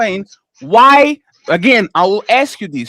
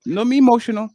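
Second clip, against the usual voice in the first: ordinary delivery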